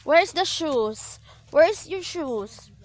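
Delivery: slow coaxing question. Real, not acted. Asking child about shoes.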